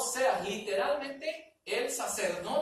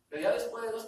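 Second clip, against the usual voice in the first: ordinary talk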